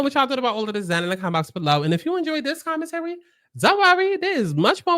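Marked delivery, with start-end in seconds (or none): 3.6-5.0 upbeat. Real, not acted. Upbeat outro promo.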